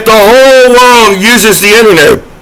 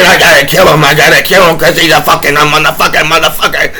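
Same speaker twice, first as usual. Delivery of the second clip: lower, fast sneering rant; mock angry hater; 5 semitones lower, faster than usual. Hater hacking his computer, mocked in a lower, fast rant. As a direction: rattle it off like a raging internet troll. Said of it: "and saying".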